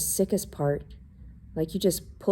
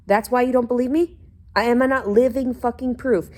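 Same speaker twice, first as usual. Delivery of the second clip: heated, higher-pitched outburst; angry rant; 5 semitones higher, 3 dB louder than usual. Real, not acted. Venting at her mother about being disbelieved, angrily. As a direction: let the frustration boil over. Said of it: "I'm fucking angry right now".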